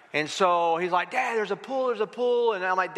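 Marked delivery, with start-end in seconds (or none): 1.1-2.5 higher-pitched imitation of an eager child; excited kid. Son telling his tired dad about the hotel pool, excited. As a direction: pester dad like an excited little boy.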